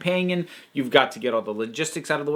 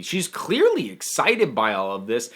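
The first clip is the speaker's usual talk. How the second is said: normal talking voice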